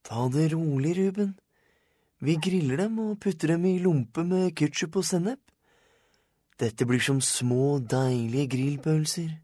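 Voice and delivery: put-on soothing boy with a sales pitch; reassuring Kent. A narrator's reading.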